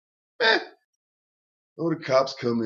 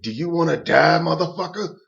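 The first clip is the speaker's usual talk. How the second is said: louder, threatening snarl; menacing threat; 5 semitones higher, 6 dB louder than usual. Retold or imitated. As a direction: threaten him over the hold. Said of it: "and Bruce says".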